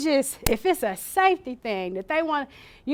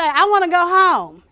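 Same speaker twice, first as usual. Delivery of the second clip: patient pleading; 6 semitones higher, 9 dB louder than usual. Retold or imitated. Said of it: "even if they say".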